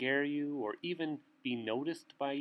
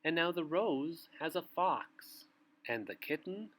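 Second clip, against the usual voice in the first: bedtime story reading. A narrator's reading. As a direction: read it like a bedtime book to little ones. Reads bedtime picture book aloud.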